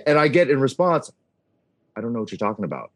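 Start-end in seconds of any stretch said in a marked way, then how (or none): 2.0-2.9 lower, softer voice for the other party; quiet, low brush-off on a retold phone call; retold puzzled denial. Travel staffer answering the announcer's callback, quiet denial.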